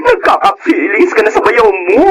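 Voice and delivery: a friend's high, shocked outburst; alarmed exclaiming. Acted.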